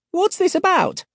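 put-on very high eager old woman; shrill old lady. A narrator's reading. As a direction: pipe up like an old busybody. Doris asks.

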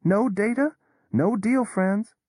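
usual speaking voice

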